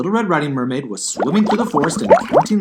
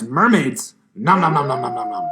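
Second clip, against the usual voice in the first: gobbling noises. Acted. Wolf eating noise.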